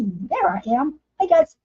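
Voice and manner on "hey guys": high bubbly hello; bright greeting. Real, not acted. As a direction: pop up on camera cheerfully.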